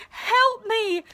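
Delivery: high pleading cry to the crowd; bawling for help. Acted. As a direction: yell for help, half sobbing. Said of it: "I bawled at the crowd".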